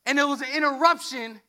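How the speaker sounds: ordinary talk, nothing marked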